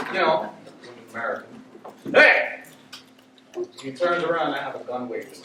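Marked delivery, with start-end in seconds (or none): 2.1-2.6 loud, high shout of hey; loud high shout at the robber. Retold or imitated. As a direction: bark it at the robber.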